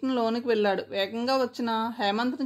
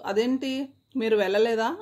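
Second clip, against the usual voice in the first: plain reading voice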